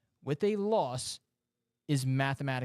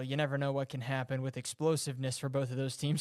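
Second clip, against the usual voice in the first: ordinary delivery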